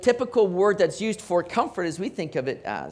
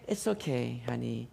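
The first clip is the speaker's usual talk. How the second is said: mock soothing; 7 semitones lower, 5 dB quieter than usual. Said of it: "I'm not sitting here saying".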